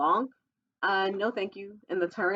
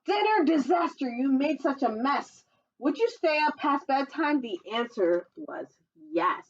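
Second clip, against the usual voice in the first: lively sing-song storybook read, higher; reading to children; 7 semitones higher than usual. Acted. Reading a dinosaur picture book to children, sing-song storytelling voice. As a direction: read the rhyme brightly to little listeners.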